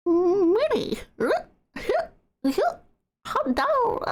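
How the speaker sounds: high falsetto cartoon mouse; Mickey Mouse impression. Shown as a demonstration. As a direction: squeak it like Mickey. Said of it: "can do Mickey Mouse".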